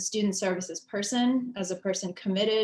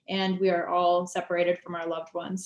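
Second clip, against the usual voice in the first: usual speaking voice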